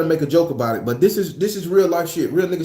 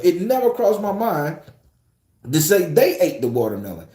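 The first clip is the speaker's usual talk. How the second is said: ordinary delivery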